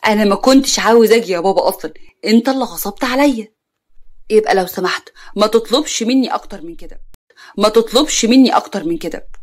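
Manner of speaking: ordinary delivery, nothing marked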